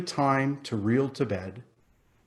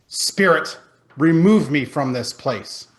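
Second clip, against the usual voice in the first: louder, desperate appeal to the spirit; anguished plea; 3 semitones higher, 9 dB louder than usual. A narrator's reading. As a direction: beg to be taken away. Scrooge begs to leave vision.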